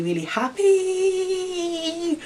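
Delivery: very high, drawn-out squeal; delighted squeal. Real, not acted. Vlogger thanking viewers for their interest, delighted squeal. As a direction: stretch it out in a high happy squeal.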